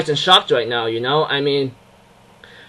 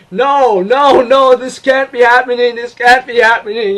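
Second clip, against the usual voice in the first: raised, louder frantic pleading; mock screaming denial; 7 semitones higher, 5 dB louder than usual. Viewer refusing the tragic scene, frantic denial. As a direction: replay an inner scream of disbelief. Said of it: "screaming in my mind".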